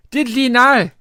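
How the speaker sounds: loud, high, hammed-up Geordie reading of "Did ye know?"; mock Geordie accent. Host reads the beer bottle to his co-host in mock Geordie accent. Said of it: "try and do a Geordie accent".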